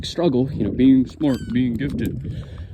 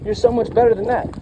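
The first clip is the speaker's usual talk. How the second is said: higher-pitched imitation of nagging adults; mock scolding; 7 semitones higher than usual. Adults scolding the gifted kid for misbehaving, mock reproach. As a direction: mimic a reproachful grown-up, pitched up. Voicing people who scolded him.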